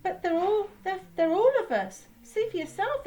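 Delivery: high, loud, stumbling protest; flustered exclaiming. A narrator's reading. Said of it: "exclaimed Douglas' driver".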